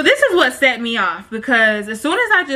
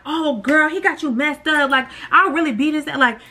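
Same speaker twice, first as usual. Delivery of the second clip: higher, hammed-up outraged bestie with a fake sob at the end; imagined supportive friend; 5 semitones higher than usual. Best friend imagined backing her up, higher and mock outraged. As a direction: play the loyal friend ready to fight. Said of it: "you would've thought she would've been like".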